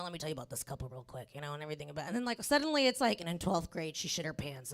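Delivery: low-pitched impression of a host's intro; officiant doing intros. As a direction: drop your voice low to mimic the MC. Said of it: "he's that guy who, like, brings up".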